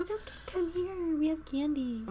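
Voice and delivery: a hammed-up sweet angel luring a child; mock angel voice. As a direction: lure her like a sugary angel. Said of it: "it's more like".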